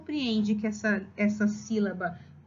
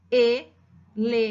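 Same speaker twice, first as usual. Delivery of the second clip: slow ELEFANTE split into e-le-fan-te; four separate beats on ELEFANTE, long pauses between; syllable by syllable; 5 dB louder, much slower than usual. Shown as a demonstration. Teacher showing what the child wrote, syllable by syllable.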